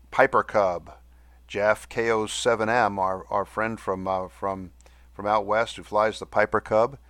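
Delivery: ordinary talk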